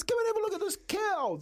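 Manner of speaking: put-on high, insistent little girl voice; imitating daughter. Daughter dragging dad to see the prize calf, high pleading imitation. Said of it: "like this, like this".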